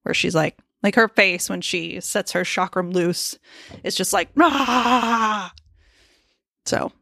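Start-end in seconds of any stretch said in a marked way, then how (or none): 4.3-5.5 short mock battle cry; mock rage roar. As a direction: growl it out like an angry warrior. Imitating Xena's rage face.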